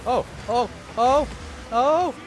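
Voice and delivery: building excitement as the ball rolls in; excited. Real, not acted. Goal chance in game.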